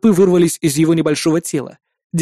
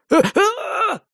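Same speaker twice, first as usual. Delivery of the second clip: high piercing scream; scream of pain; much slower than usual. Acted. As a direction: howl in agony. All limbs pierced at once. (пронзительный крик боли)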